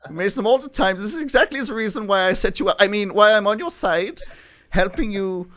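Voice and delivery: high, loud protesting koala voice; indignant koala character. Acted. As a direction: protest theatrically in a high put-on accent. GM answers players as koala N.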